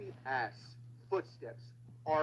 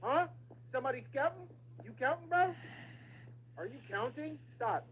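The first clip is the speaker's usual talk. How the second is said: higher, louder disbelieving outburst; exasperated yelling; 10 semitones higher, 5 dB louder than usual. Real, not acted. Gamer failing again, yelling at the screen in exasperation. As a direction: rant at the game in disbelief. Frustrated at repeated deaths.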